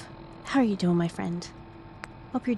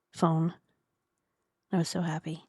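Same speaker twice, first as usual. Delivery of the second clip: ordinary talk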